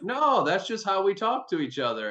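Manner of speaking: high-pitched mock reassurance; retold brush-off. Player telling his coach it was no fight, dismissive. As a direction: imitate a friend waving it away, voice up high. Acting out the friend's reply.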